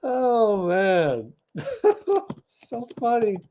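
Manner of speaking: high, laugh-filled voice; words coming out through real laughter; recovering from a laughing fit; still laughing. Real, not acted. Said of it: "I'm still laughing at that".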